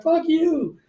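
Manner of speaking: high-pitched retort; angry comeback. Retold or imitated. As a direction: imitate the caller cursing back. Caller's curse back, acted out.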